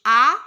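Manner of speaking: "A" said loud and open, like ah; "A" as a long open French ah; French vowel demo. Shown as a demonstration. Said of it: "it's like A in English".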